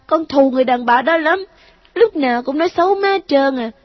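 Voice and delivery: put-on high child voice, pleading then bitter; a wounded boy begging his mom; hurt ghost child. A narrator's reading. Ghost son begs mother not to send him back, high child voice.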